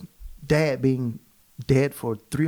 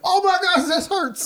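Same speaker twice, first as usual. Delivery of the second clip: waking up in pain; 4 dB louder than usual. Retold or imitated. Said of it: "You wake up like".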